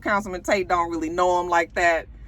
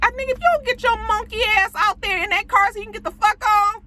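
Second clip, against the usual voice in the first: very high, strained rant; hammed-up high outburst at a dawdler; exasperated mock scolding; 13 semitones higher than usual. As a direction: scold him in a high-pitched rush of exasperation.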